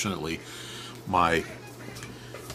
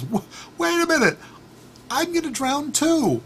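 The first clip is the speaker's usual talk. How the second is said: very high, squealing alarm; panicking scorpion; 4 dB louder than usual. Acted. Scorpion realizing he will drown too, in squeaky panic.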